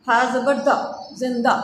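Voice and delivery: usual speaking voice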